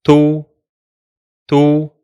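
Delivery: "tu" as one short open syllable; syllable drill; syllable demo. Shown as a demonstration. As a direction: say "tu" briefly, twice. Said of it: "The second is".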